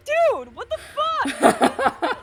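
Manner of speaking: high, loud, drawn-out disbelief; caught off guard. Shown as a demonstration. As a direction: exclaim it in shocked protest, half laughing. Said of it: "more seriously, like, caught off guard".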